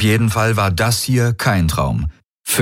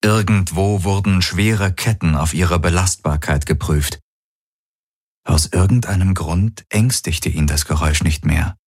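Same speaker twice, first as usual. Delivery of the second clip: ordinary delivery, nothing marked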